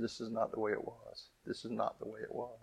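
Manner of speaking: put-on soft, hurt little girl; quietly retold sobbing child. Scene: little girl mourns her broken shoe, softly tearful. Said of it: "and she cried".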